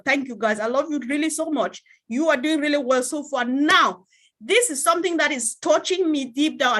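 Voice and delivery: normal talking voice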